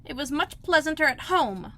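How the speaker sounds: put-on a girl grumbling to herself; Alice's voice. A narrator's reading. Alice grumbling to herself, a lightly voiced young girl.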